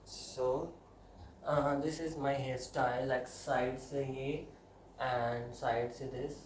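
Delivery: very slow presenting voice; slow showcase. Real, not acted. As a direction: show off the sides slowly.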